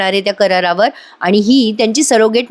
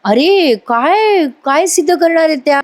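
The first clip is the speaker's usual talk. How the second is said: higher, dragged-out scoffing Arya voice; 6 semitones higher than usual. Retold or imitated. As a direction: scoff at the aunt's threat, voice pitched up. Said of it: "मग ही आर्या म्हणते".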